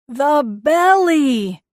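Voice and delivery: very slow, loud, hoarse call; raw shout. Acted. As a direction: yell it till the throat goes raw. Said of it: "shouted until his throat went raw".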